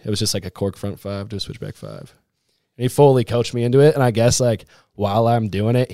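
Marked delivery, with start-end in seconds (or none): none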